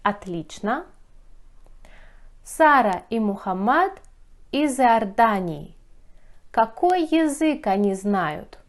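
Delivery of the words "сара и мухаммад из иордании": normal talking voice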